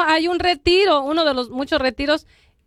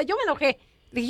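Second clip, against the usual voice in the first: ordinary talk, nothing marked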